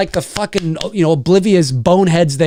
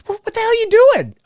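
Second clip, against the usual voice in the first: shocked outcry. Retold or imitated. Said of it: "It's like".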